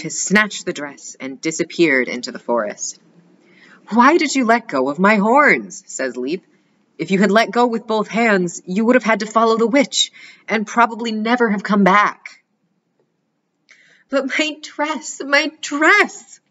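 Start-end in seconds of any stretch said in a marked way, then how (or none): 3.9-12.4 higher gentle elk voice; lightly voiced elk. A narrator's reading. The elk Leap speaks. 14.1-16.4 high, slow sobbing cry; sobbing princess. A narrator's reading. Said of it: "sobs Princess Cottongrass".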